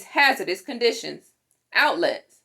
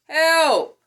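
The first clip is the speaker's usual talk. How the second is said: loud, high cry; a trapped person yelling help; call for help; 6 semitones higher, 5 dB louder than usual. Acted. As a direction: shout from inside the burning house.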